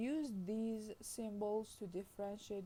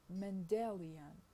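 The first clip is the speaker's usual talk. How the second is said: Mendelian stressed on DEL, drawn out slowly; stress on second syllable; 3 dB louder, slower than usual. Shown as a demonstration. Lecturer shows a stress shift, slow stress on second syllable. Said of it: "in Mendelian it is on the second".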